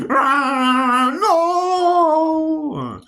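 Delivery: mock panicked cry. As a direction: draw it out high in mock terror. Acting out the film's gangster scene.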